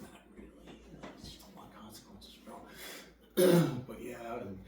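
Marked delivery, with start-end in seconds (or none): 0.0-2.5 sheepish whisper. Retold or imitated. Retold admission to the sponsor.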